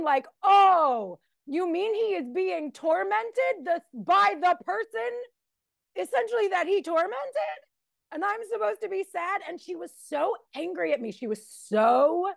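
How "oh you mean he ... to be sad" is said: high, incredulous mock outrage; self-righteous indignation. Retelling her reply to mother, high and incredulous. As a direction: scoff at the idea, pitched up high. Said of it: "the self-righteous indignation".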